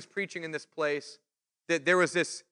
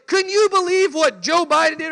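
louder and much higher, scandalized; outraged gossip; 10 semitones higher, 9 dB louder than usual. Retold or imitated. Acquaintance griping about Biden, scandalized outrage. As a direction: gasp it out like juicy political gossip. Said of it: "They'll say".

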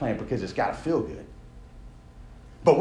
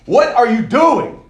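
loud, slow jeering taunt; mocking shout; 8 semitones higher, 10 dB louder, slower than usual. Opponents jeering at Nehemiah, loud slow taunt. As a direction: yell it down at the builder.

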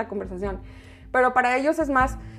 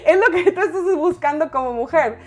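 laughing; 3 semitones higher, 4 dB louder than usual. Real, not acted. Laughing at her own joke.

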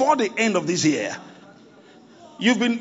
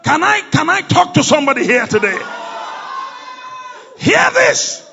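rally speech; 5 semitones higher, 4 dB louder than usual. Acted. Preacher rousing the congregation.

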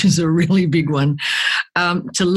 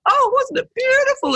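very high, overcome moviegoer; high-pitched imitation of emotional rapture; mock weepy gushing. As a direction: gush as if moved to tears, hammed up. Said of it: "I'm laughing and crying and going".

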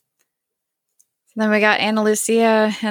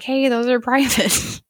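mock indignant; 6 semitones higher than usual. Sun scolds Kate over messages.

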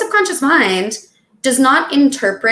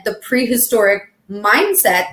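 ordinary delivery

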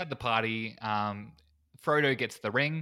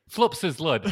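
louder, exaggerated Kiwi "flups his lud"; broader New Zealand accent; 6 semitones higher, 8 dB louder, slower than usual. Shown as a demonstration. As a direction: overdo the New Zealand short vowels. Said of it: "the terrible New Zealand thing".

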